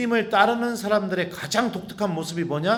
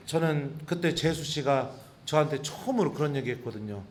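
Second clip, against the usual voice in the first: ordinary talk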